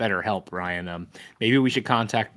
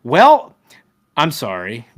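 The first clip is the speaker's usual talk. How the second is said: mock apology; 3 semitones higher, 10 dB louder, slower than usual. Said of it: "was like".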